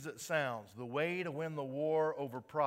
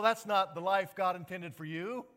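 normal talking voice

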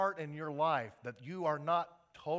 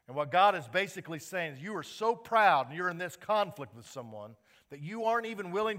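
louder preaching, driving each point home; full-force preaching; 5 semitones higher, 10 dB louder than usual. Acted. Pastor pressing the proud congregation, in louder full-force preaching.

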